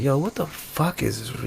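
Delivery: very soft, low aside; hushed retold grumble; muttered reaction. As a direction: mutter it to yourself in disbelief.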